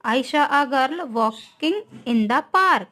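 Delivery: reads the sentence very slowly, word by word; slow dictation. Shown as a demonstration. Teacher dictating an example sentence to students, very slow. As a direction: spell it out slowly for the class. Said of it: "teacher reads example sentence slowly".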